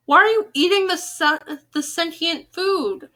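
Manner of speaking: high, slow, disbelieving question; incredulous. Real, not acted. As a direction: react in mock horror at the poem. Incredulous at poem's eating.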